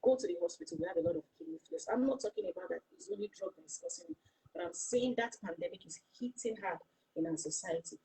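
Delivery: usual speaking voice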